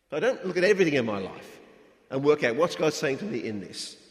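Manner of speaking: usual speaking voice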